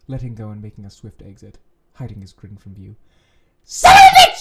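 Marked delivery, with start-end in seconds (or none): 3.6-4.4 very high, loud furious scream. A narrator's reading. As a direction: scream the curse out. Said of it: "you scream".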